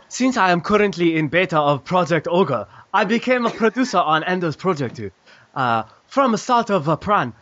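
half-hearted Japanese accent impression with giggles; mock Japanese accent. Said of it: "That was some, uh, pretty racist Japanese".

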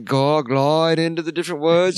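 mock Ozzy British accent. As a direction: chat like [name] in a mild English accent. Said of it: "I came from the south side of the Bronx of England".

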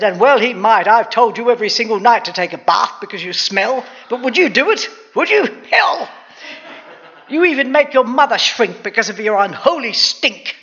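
loud nagging tirade; scolding mother. A narrator's reading. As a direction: build to a high, exasperated outburst. Mother scolds smelly son.

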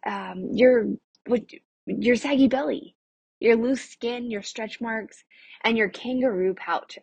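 usual speaking voice

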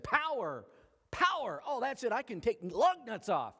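high, punchy mock thrill at power; mock excitement. Preacher mimics people thrilled at lug-nut power, mock excited. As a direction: act overexcited at getting power. Acting out misguided glee over power.

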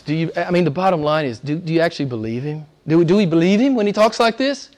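ordinary talk, nothing marked

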